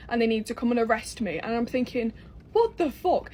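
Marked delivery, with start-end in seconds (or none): 2.5-3.3 much higher incredulous what the fuck; shocked disbelief. Retold or imitated. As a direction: squeak it in total disbelief. Retold inner disbelief.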